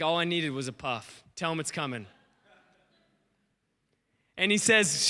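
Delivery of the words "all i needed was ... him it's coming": low, soft and matter-of-fact; understated confidence. Retold or imitated. Elijah answering his servant, quietly confident. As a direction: drop it low, quietly sure. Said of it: "He's like".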